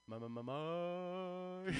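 loud, slow ma ma test syllables; drawn-out sound check into the mic. Shown as a demonstration. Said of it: "can you hear my voice?".

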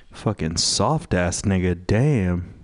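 ordinary talk

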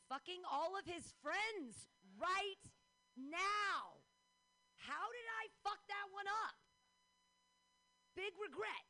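loud, high comic wailing; mock outraged outburst. Comic lamenting her one regret to the crowd, hammed-up shouting. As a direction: explode with exaggerated regret.

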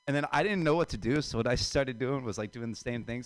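ordinary talk, nothing marked

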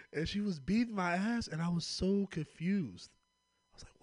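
ordinary talk

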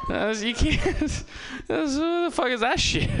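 high, laughing outrage; laughing disbelief. Real, not acted. Comic mocking prank videos, laughing in disbelief.

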